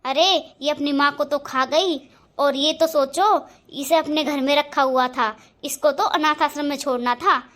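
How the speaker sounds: loud, fast, venomous tirade; furious rant. Acted. Stepmother raging to husband about stepdaughter, shouting. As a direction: scream it, calling the girl a snake.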